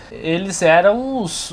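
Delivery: usual speaking voice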